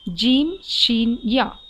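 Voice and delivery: "जीम", "शीन" drawn out slowly, then "या"; slow letter demo. Shown as a demonstration. Teacher slowly sounding out the letters for students. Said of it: "दरमियानी वाले हिस्से से निकलता है".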